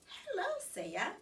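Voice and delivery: a hint of a careful hostess; mother greeting guest. A narrator's reading. Mother practices greeting her daughter at the door, lightly voiced. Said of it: "she said".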